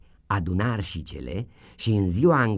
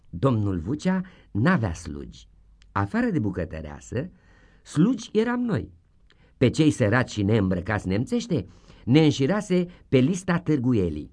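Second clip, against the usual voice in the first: plain reading voice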